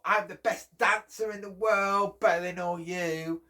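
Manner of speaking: mock boasting child. Child showing off to friends, high and loud. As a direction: crow over your friends.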